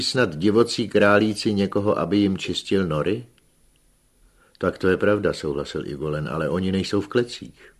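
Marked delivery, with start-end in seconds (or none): none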